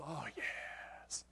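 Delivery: a secret, reverent whisper in the dark; hushed "oh, yes" to himself; awed whisper. Retold or imitated. Hunter alone in stand, awed.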